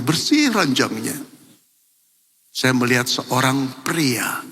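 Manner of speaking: ordinary talk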